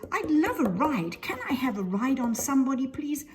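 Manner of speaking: high, loud, eager request; very excited. A narrator's reading. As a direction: beg eagerly for a turn. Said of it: "They get very excited".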